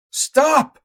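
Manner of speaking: high, loud desperate cry. Acted. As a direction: shout to stop him. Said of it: "I cried".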